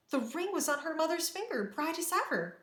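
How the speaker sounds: high-pitched, wondering narration; amazed revelation. A narrator's reading. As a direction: reveal it with astonishment. Narrator reveals the ring, amazed.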